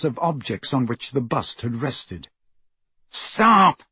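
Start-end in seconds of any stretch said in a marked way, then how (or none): 3.1-3.8 loud, high command; Malfoy shouting at Crabbe. A narrator's reading. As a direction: order him to stop. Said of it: "Malfoy shouted".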